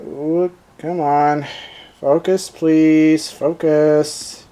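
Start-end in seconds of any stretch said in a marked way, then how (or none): none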